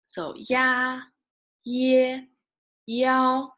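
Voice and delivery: ya, ye, yao each said high and clear as a teaching model; Mandarin syllables ya ye yao. Shown as a demonstration. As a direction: say ya, ye, yao slowly for students to copy. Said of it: "if we need to say ya".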